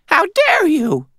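high, drawn-out squeaky outrage; squeaky old lady. A narrator's reading. Old lady with a revolver to intruder, squeaky indignation. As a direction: scold in a loud, squeaky old-woman voice. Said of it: "her voice squeaky".

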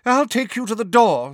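put-on high, brisk old neighbour; bossy Mrs Figg. Neighbour escorting Harry home, bossy.

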